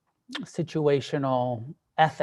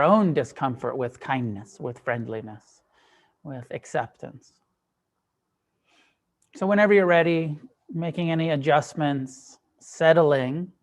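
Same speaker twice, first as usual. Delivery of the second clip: unhurried meditation guidance with long pauses; guided meditation. Acted. Teacher guiding a group to soften and relax, guided meditation. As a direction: ease them gently into stillness, leaving space between phrases.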